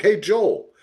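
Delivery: ordinary talk, nothing marked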